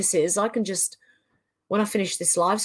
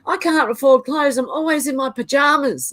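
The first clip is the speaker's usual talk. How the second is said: joking, higher-pitched complaint; mock lament; 6 semitones higher, 3 dB louder than usual. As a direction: play up being hard done by, just kidding. Mock lament, then says she's kidding.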